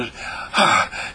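unvoiced exhale; tired sigh. Shown as a demonstration. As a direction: let the breath go. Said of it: "all out of breath".

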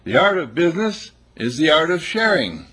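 high, loud, proclaimed motto; grand declaration. Acted. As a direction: declaim it like a sermon.

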